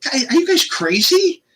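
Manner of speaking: high-pitched exasperated protest; frustrated. Retold or imitated. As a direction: re-enact your incredulous frustration. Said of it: "I'm getting frustrated".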